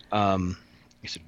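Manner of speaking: normal talking voice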